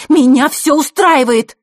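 slow, very loud high shout. Acted. Scene: Zakhara leaping up and shouting at her guest. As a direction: yell it as she jumps up.

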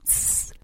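soft, voiceless "tsss"; hissed shush. A narrator's reading. Girl hushing a curious friend, hissed shush. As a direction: hiss for silence, barely there. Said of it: "зашипела Лёля".

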